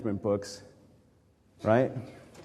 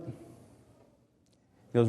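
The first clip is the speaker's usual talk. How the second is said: normal talking voice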